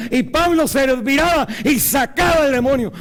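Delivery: loud, high shouted preaching. Real, not acted. Preacher shouting the story of Paul to the congregation. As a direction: proclaim it to the rafters.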